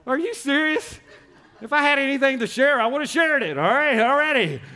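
comic high-pitched mock outrage; exasperated disbelief. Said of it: "I was like".

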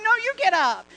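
high, loud playful retort; wife answering her husband in a playful spat, loud; mock bickering back. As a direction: fire it right back, teasing. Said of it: "And I'm like".

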